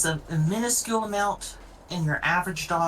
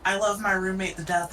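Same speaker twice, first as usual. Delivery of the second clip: ordinary talk, nothing marked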